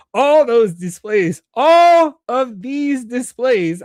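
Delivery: loud, high, drawn-out words; exaggerated re-reading. Real, not acted. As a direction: stretch it out in a slow playful shout. Exaggerated retakes after misreading a line.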